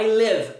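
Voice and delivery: "live" said close to lev; short lax vowel in "live"; correct short vowel. Shown as a demonstration. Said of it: "você vai falar".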